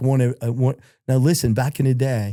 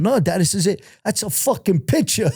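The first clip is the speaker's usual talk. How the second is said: raised, pleading protest to dad; higher, insisting it's just a picture; insistent protest; 7 semitones higher than usual. Retold or imitated. Son protesting to his father.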